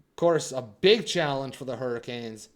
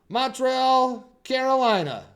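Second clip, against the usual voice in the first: announcer call; 9 semitones higher, 10 dB louder than usual. Acted. Announcing tonight's matchup.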